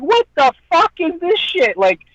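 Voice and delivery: high-pitched, exasperated ranting; worked-up, indignant outburst; pissed-off rant. Real, not acted. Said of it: "it pissed me off like you wouldn't imagine".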